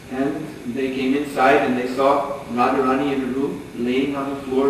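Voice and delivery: usual speaking voice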